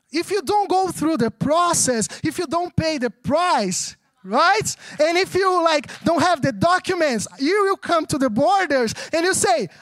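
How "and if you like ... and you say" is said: ordinary talk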